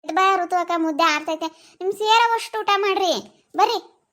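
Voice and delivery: hint of a grandpa calling her to eat; imitating grandpa. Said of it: "ತಾತ".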